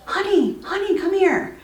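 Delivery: high, urgent call across the room. Retold or imitated. Wife calling her husband into the bathroom, urgent.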